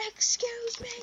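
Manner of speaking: high, affronted excuse me; so offended. Retold or imitated. Drunk guy offended again by the kid, high affronted. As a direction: act deeply offended. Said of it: "This guy gets so offended".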